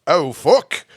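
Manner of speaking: repeated practice in mock British; exaggerated British accent drill; bad British accent. Shown as a demonstration. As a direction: keep trying the fake British curse. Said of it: "This guy is getting better".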